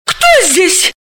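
high, startled cry. Acted. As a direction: jump at a stranger's voice. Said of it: "подскочила Далила". (испуганно и удивлённо)

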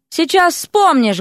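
high, drawn-out angry call; threatening bark. A narrator's reading. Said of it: "гаркнула Вася".

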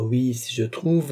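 ordinary delivery

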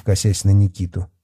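ordinary delivery

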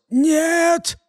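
breathy, high hoarse exhale; hoarse gasp. Acted. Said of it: "сипло выдохнул узник".

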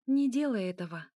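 usual speaking voice

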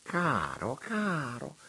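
high, cooing "caro"; mildly fond prelate repeating himself; fond repetition. A narrator's reading. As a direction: croon it fondly. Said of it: "ripeté Monsignore".

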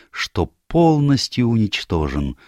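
usual speaking voice

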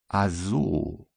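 slightly drawn out. A narrator's reading. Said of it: "ein wenig gedehnt".

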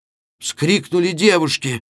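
usual speaking voice

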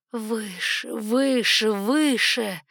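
building to loud exultant call; urging cry. A narrator's reading. Dragon soaring upward.

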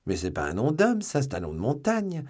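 mildly flustered protest, pitch jumping at the end; losing composure. A narrator's reading. Interrogator baffled by a prisoner's odd name, flustered. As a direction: protest as if starting to lose your head. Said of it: "qui commençait à perdre la tête".

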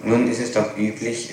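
usual speaking voice

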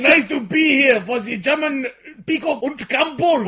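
loud, high, slow mock German; hammed-up German host yelling; shouted German accent. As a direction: bellow it in a silly German voice.

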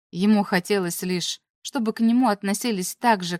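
ordinary delivery

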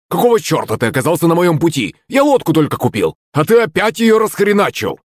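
high-pitched outraged complaint; heated, high character voice; roaring in anger. A narrator's reading. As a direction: rant back, pitch climbing to the end. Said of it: "взревел Захарыч".

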